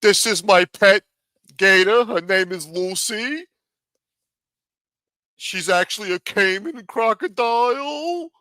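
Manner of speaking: hammed-up Florida gator guy; mock gator keeper. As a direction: play a proud reptile handler showing off. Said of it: "He could go swimming with the gators".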